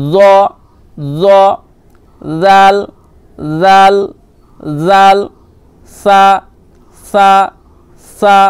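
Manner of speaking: জ with a full rounded mouth, then জাল and সা, each repeated; full-mouthed letter drill. Shown as a demonstration. Teacher drills the letters for students, full-mouthed জ. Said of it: "মুখখানা একটু পুর করবেন".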